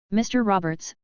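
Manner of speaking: ordinary delivery, nothing marked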